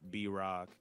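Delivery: ordinary talk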